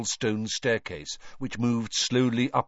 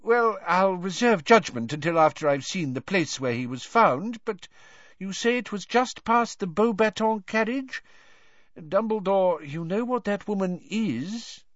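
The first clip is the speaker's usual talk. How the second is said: high, insinuating official voice; hedge, then hint with suspicion; flustered minister; 11 semitones higher than usual. A narrator's reading.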